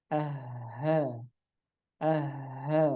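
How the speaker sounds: soft "Aha" with an airy, light H; light thin H. Shown as a demonstration. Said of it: "dia harus tetap tipis". ("aha" tipis dan ringan)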